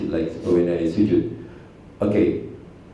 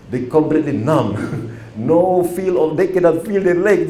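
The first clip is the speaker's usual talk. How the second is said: high, loud amused retelling, with laughs; giggling, raised voice, amused disbelief; laughing while telling; 7 semitones higher, 4 dB louder than usual. Real, not acted. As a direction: laugh through it, delighted at their numbness. Laughing at frozen students.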